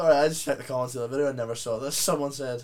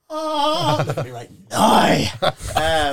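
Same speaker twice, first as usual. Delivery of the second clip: excited shout; 7 semitones higher, 7 dB louder, much slower than usual. Real, not acted. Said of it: "You should cosplay next year".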